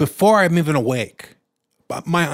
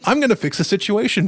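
fix-it voice; 9 semitones higher than usual. Shown as a demonstration.

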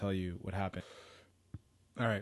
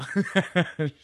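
big burst of laughter; loud laugh; 9 semitones higher, 11 dB louder than usual. Real, not acted. Laughs at own joke.

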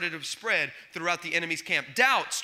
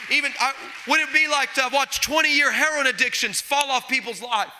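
loud, raised preacher's cry to the congregation; full-force preaching; 5 semitones higher, 5 dB louder than usual. Acted. Pastor preaching to the church in a loud, rising voice. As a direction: preach it out, pushing every line.

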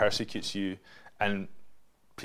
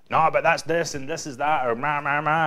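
mock emotional self; 4 semitones higher, 4 dB louder than usual. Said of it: "I'll just get emotional about it".

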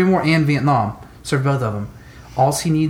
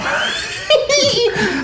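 high burst of laughter. Real, not acted. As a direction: let out a big high laugh. Laughs at own story.

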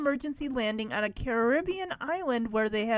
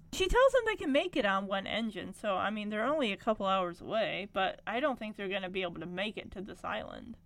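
ordinary talk

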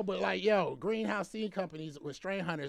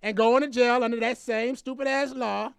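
heated rant; 7 semitones higher, 9 dB louder than usual. Real, not acted. Ranting at Biden over weed law.

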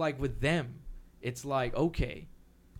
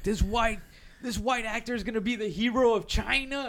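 mock indignant voice pitched way up; mocking outraged public; 10 semitones higher, 5 dB louder than usual. Mocking what everyone said about the Great Wall casting, high indignant voice. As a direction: parody the outraged crowd, high and loud. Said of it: "And everyone's like".

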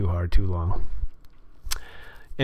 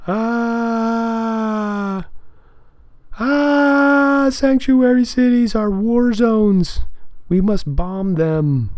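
much higher, slow hammed-up alarmist voice; mock fearmonger; 12 semitones higher, much slower than usual. Host mocking Fox News fearmongering in a high, slow, hammed-up voice. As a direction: ham up a panicked pundit.

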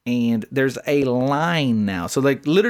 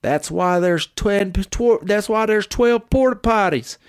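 higher, slow dismissive drawl; line-skipping country guy; 7 semitones higher, slower than usual. Retold or imitated. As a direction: play the smug line-cutter, higher. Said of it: "he looks at me and he goes".

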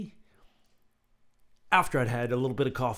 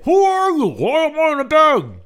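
much louder, high panicked yell; 14 dB louder than usual. A narrator's reading. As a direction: shout it from inside the bag. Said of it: "yelled Henry".

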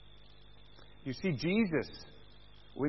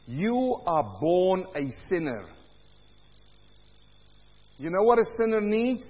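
usual speaking voice

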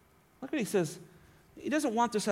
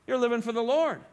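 ordinary delivery

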